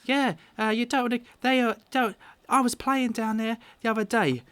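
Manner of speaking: put-on high-pitched eager little boy; child blurting out news; Carp, piping up. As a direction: pipe up as an excitable young lad. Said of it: "And Carp pipes up".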